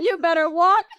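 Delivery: high, loud laughing jab; laughing taunt. Real, not acted. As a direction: tease him through laughter. Mocking advice while laughing.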